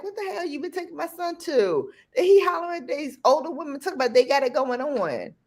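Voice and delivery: high, fast mock telling-off; mock hollering. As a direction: act out a mother scolding the dad, rapid and high. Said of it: "I had to holler at his dad".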